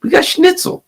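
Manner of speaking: very high squeaky cartoon German voice; mocking German patriots in a high squeaky voice. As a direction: ham up a German guy fretting over his schnitzel. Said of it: "German people that are like".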